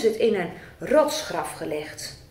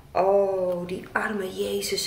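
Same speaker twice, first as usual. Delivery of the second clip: whisper; 4 semitones lower, slower than usual. Acted. Pitying whisper.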